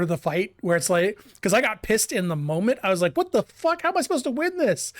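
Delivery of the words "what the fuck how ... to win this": high, exasperated cry of disbelief; frustrated outburst. Retold or imitated. Gamer recalling boss fight, high frustrated yelp. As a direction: yell at the impossible boss.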